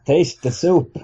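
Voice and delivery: put-on slow Yiddish accent, through a laugh.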